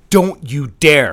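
slow, loud furious order; furious shout. Acted. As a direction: bark it as a forbidding command. Boss forbids her walking out.

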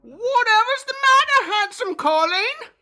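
high Irish crone voice; Irish old woman. Acted. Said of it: "I'm old clubfoot".

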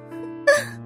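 brief high laugh; amused chuckle. Acted. Boss toys with eager employee, amused chuckle. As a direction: chuckle mid-command.

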